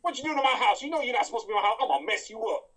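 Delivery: high, loud Madea voice; a hammed-up Madea threatening the intruder; Madea impression. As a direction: shout it like an outraged Madea. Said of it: "Where's Madea?".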